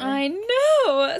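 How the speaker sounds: high, loud exclamation of agreement; excited. Real, not acted. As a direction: shout it in total agreement. Emphatic agreement about the boy.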